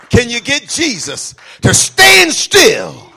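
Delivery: high, slow, full-force preacher's question; shouted challenge. Real, not acted. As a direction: challenge the congregation at full volume.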